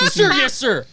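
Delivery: loud, high crisp soldier answer; soldier reply. Acted. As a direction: snap back like a recruit. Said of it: "You saluted him".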